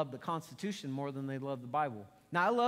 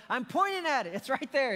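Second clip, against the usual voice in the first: higher, louder exasperated retort; frustrated; 8 semitones higher, 5 dB louder than usual. Retold or imitated. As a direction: scold the kids for missing the obvious. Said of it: "I just get frustrated".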